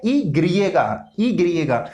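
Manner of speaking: slow ई ग्रीए का spelled out; name the letter ई ग्रीए slowly; Spanish letter name. Shown as a demonstration. Teacher names the Spanish y for students, slow. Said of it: "वाई का sound है वो भी क्या है".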